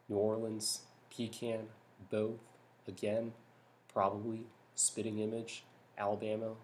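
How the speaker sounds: even list reading, one word at a time; natural word-list reading. Shown as a demonstration. Demonstrates own accent for an accent tag, natural word-list reading. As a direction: read each word off naturally, separated by short pauses. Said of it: "this is the way I would pronounce it".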